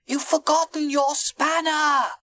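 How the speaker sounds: high-pitched call over a distance; high, urgent character voice. A narrator's reading. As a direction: call after the departing boat. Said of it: "Edward called out".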